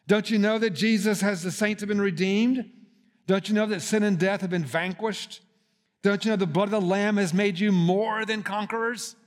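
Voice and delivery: repeated don't you know, building to a peak; fervent preaching. Acted. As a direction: preach it with mounting fire. Preaching climax.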